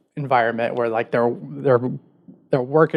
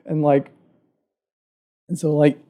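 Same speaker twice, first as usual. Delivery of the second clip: normal talking voice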